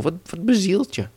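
high-pitched indignant outburst; yelling devil. Acted. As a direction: demand an explanation, stammering at first. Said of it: "schreeuwde de duivel". (verontwaardigd uitroepen, hoge stem)

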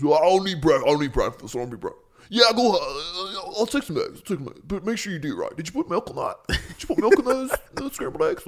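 mock him like a demanding guest; morning voice. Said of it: "It's his morning voice".

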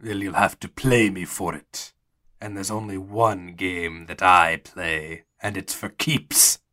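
put-on menacingly measured card player's accented voice; gambler with put-on accent. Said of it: "he's, like, putting on an accent".

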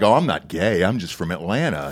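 lightly voiced Southern TV personality; a hint of soft Atlanta Southern voice; Chrisley impression. Telling people he is just from Atlanta, lightly imitated voice. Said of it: "it's a lot like Chrisley".

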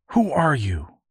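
put-on a young boy standing his ground; demanding boy. Woodrow confronting the stranger, demanding. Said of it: "Woodrow demanded".

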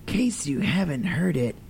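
whispered echo of the sign-off. Acted. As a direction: murmur it back under your breath.

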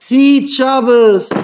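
high, loud, very drawn-out command; exhorting shout. Acted. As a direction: shout it slowly, stretching each word. Urging Sabbath observance in acted argument. (הויכער, פארצויגענער באפעל)

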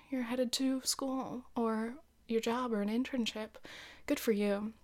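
soft, unhurried meditation voice; guided meditation. Acted. Leads a mock guided breathing exercise.

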